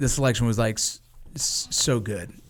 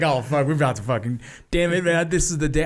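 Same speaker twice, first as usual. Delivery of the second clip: excited; 3 semitones higher than usual. Retold or imitated. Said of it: "getting emotional".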